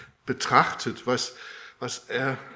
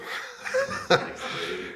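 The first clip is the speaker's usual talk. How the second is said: sudden high-pitched laugh of surprise; high squealing laugh. Real, not acted. Audience help spills milk. (hoher, quiekender Lacher)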